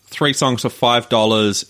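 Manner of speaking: ordinary talk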